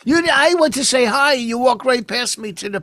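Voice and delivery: loud, high-pitched grievance; indignant accusation. Real, not acted. Partner complaining about being walked past for the puppy, indignant.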